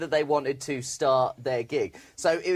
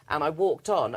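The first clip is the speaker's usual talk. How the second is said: ordinary talk, nothing marked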